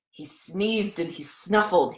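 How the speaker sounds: ordinary delivery, nothing marked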